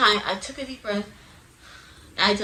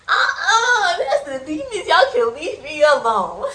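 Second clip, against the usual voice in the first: laughing refusal; 9 semitones higher, 10 dB louder, slower than usual. Retold or imitated. Laughing while shooing demons.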